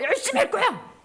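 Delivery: high, bright and laughing; cheerful worker; eager resolve with a laugh. Shown as a demonstration. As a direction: sound keen to work. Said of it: "한번 해보세요".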